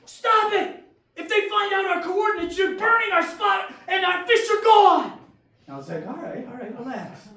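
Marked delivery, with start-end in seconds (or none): 0.1-5.1 high, loud frantic rant; screaming in panic. Acted. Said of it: "Nico screams". 6.1-7.3 softer, placating reply; calming. Acted. Calming the screaming friend down.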